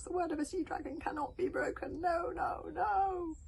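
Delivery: high little dragon wailing; distressed, high-pitched dragon; shrieking Toothless. A narrator's reading. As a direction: protest in a high squeal. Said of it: "shrieked Dragon Toothless in his thoughts".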